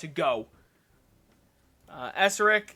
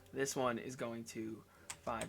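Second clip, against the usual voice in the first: normal talking voice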